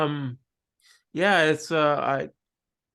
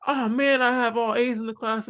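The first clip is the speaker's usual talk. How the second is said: whiny student; 8 semitones higher than usual. Retold or imitated. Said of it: "they're like".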